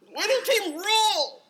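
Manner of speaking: high, loud exasperated shout; loud outburst. Acted. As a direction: shout it in mock frustration. Continued outburst.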